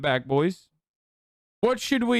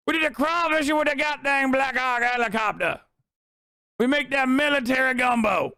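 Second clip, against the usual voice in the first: Clay Higgins impression; 7 semitones higher than usual. Streamer parodies congressman's Louisiana pitch with hammed-up Cajun shouting. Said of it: "Clay Higgins".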